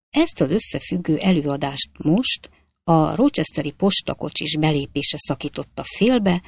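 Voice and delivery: usual speaking voice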